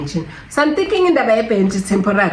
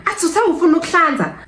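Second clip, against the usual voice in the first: high, fast mocking retort; much higher quick nurse impression; 11 semitones higher, faster than usual. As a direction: toss it off as the annoyed nurse. Quoting nurse's irritated retort.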